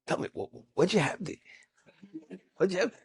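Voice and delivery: soft secretive question; confidential. Retold or imitated. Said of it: "he leans over and he says".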